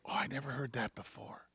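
low, very soft wonder; hushed, understated surprise; retold quiet amazement. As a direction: murmur it as a private discovery. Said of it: "I just go".

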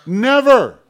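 "Never" shouted high and loud on its own; stress on Never. Real, not acted. Pastor rejects a cruel God with a loud "Never". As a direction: slam down "Never" as a final word. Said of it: "emphatic denial".

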